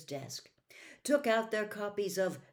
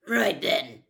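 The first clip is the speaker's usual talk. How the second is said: gruff; 3 semitones higher, 10 dB louder than usual. Acted. Moody taking charge gruffly.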